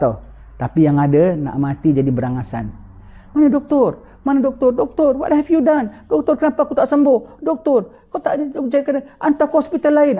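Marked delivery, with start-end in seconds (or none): 3.3-10.2 high, frantic demands of a dying man; panicked dying patient. Acted. Dying man begging the doctor, high and frantic.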